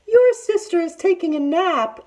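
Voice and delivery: high, sugary put-on auntie voice; sweet fake auntie. A narrator's reading. Tiger to Big Sister, luring her in sweetly. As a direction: lure her in with false sweetness. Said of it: "Auntie Tiger said sweetly".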